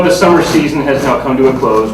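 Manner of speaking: ordinary delivery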